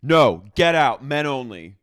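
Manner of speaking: loud, slow, bossy shout; mock shouted order. Husband ordering his wife away from the grill, shouting. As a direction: bark them out of the yard. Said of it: "Jesse immediately goes".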